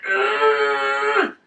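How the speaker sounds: low, imitated lifting grunt; straining grunt. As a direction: strain as if heaving a heavy bar.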